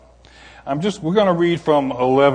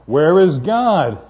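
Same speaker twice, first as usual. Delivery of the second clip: louder, slow, stern demand; even sterner; 4 semitones higher, 9 dB louder, much slower than usual. A narrator's reading. Pastor pressing the silent boy, sterner and louder. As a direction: lean on every word, slow and firm. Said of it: "even sterner tone".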